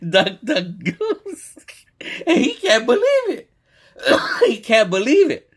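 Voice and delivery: very high voice through laughter; real laughing. Real, not acted. Retelling the clip to listeners, laughing helplessly. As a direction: crack up while talking, voice shooting up. Cracking up at the played testimony.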